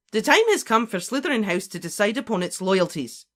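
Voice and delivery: high, loud, commanding; forceful professor's voice; interrupting professor. Acted. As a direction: cut in with stern authority. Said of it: "interrupted Professor McGonagall".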